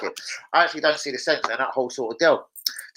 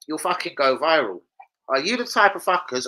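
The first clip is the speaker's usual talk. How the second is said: usual speaking voice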